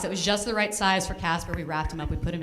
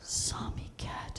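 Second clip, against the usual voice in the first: slow hushed whisper; eerie whisper; 14 dB quieter, much slower than usual. Retold or imitated. Realizing the cat is alive, a slow dread whisper. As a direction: breathe it slowly in dread.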